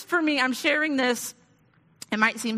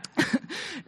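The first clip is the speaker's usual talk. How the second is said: breathy self-mocking laugh; 7 semitones higher, 4 dB quieter than usual. Real, not acted. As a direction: laugh at yourself. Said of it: "laughs at own self".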